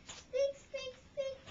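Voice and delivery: high, loud squeaks then the mouse's boast; squeaky mouse. Acted. Mouse's second note.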